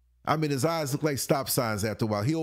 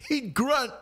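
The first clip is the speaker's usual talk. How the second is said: ordinary talk, nothing marked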